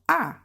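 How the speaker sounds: high AH dropping fast; fourth tone. Shown as a demonstration. Teacher repeats the stop-like tone, short falling AH. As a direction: cut AH short with a sharp fall. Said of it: "Just like a stop".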